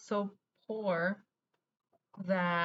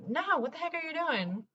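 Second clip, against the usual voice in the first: higher, alarmed mock yell of a mom; mom freaking out; 9 semitones higher than usual. Mom stops uncle shooting the child's hand, alarmed higher cry. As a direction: imitate a panicked mother stopping him. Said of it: "my mom freaked out".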